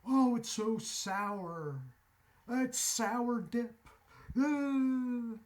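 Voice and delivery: high, slow, drawn-out mocking voice; exaggerated sour complaint. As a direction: parody a reviewer wincing at sourness. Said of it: "a really big channel back in the day".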